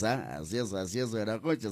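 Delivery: usual speaking voice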